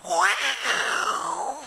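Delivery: drawn-out, high stunned wow; slow amazed exclamation. Real, not acted. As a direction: let the wow hang in disbelief. Wife's fury on coming home.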